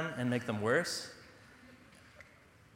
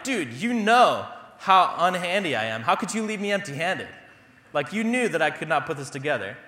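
whiny complaint; 4 semitones higher, 6 dB louder than usual. Retold or imitated. Complaining to Eric in his head.

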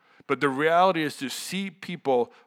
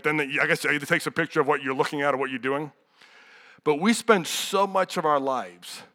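usual speaking voice

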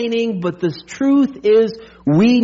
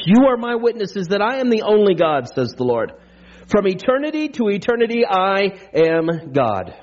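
scripture reading; 5 semitones lower than usual. Acted. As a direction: read the verses like a proclamation. Preacher reads scripture aloud.

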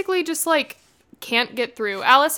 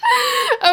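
squealing laugh. Real, not acted. Laughter cry.

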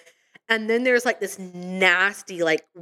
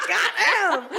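high, laughing "God damn."; laughing exclamation; 10 semitones higher than usual. Retold or imitated. Reacting to the clip of Zoe, high laughing exclamation. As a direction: burst out laughing at it. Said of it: "And I was like".

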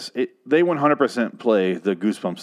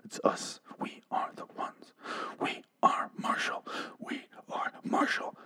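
whispered, softer mock heroics; 14 dB quieter than usual. Mocking the survivors' victory speech in a whisper. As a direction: breathe the lines like a secret vow. Mocking characters' triumphant lines.

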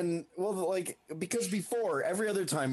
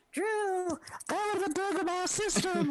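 high-pitched, tiny imitation voice; tiny little voice. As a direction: squeak it like someone very small. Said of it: "hear his tiny ass voice saying".

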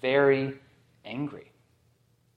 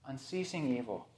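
soft echo; 14 dB quieter than usual. Shown as a demonstration. Preacher lets the phrase sink in, softly. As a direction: let "Unceasing evil" fall away to a near hush. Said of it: "of unceasing evil".

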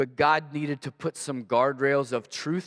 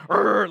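loud, higher-pitched "rrr" engine noise; imitated engine revving; 7 semitones higher, 7 dB louder than usual. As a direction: rev like a straining engine. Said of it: "like, getting louder".

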